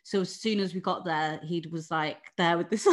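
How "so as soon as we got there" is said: ordinary talk, nothing marked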